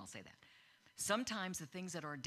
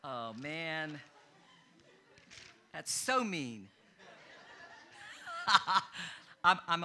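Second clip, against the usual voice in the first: real laughter breaking through the words; can't stop laughing mid-sermon; 7 dB louder, slower than usual. Real, not acted. Preacher to congregation after a joke, laughing.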